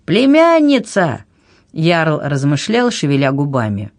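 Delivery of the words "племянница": high, drawn-out cry; slow, high-pitched indignant shout; chorus shout. A narrator's reading. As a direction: shout it like a crowd. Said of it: "поддержали его хором".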